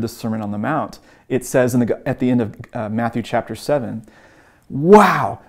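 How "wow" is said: louder, higher wow; drawn-out retold amazement; amazed. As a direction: imitate an awestruck onlooker. Retold crowd amazed at Jesus.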